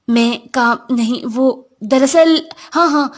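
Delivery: slow, halting, caught off guard; hesitant flustered reply; flustered stammer. Acted. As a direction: fumble for words, stretching them out.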